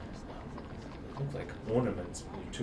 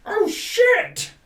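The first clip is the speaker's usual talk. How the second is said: very high, loud panicked yelp; a yelp from high up a tower; high startled squeal; distant cry; 14 dB louder than usual. Acted. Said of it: "you hear someone".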